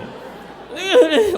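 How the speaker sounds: high, loud mock sob; squealed imitation of ugly crying; ugly-crying sound. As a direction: imitate a wet, messy crying wail. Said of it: "I was like Julianne Moore crying".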